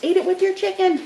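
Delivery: high-pitched mock yell; mock scream. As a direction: shriek it like a nagging viewer. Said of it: "Somebody's probably screaming at me".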